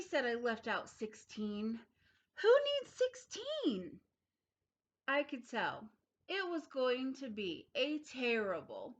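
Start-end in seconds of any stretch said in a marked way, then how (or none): 2.4-4.0 high, slow, crying protest; whiny cry. Acted. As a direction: wail it like a sulky child. Child protests, crying sound.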